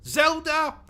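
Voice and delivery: name spat out in a sudden high yelp; scornful yelp. Acted. Mocking his partner's favourite game with a high yelp. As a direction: jump way up in pitch in disgust. Scornful outburst at rival's question.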